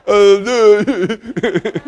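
high burst of real laughter; laughing hard. Real, not acted. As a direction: crack up at the story. Laughing at his own story.

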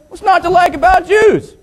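very high, put-on indignant retort; mock indignation. Pilot challenging his co-pilot, mock indignation.

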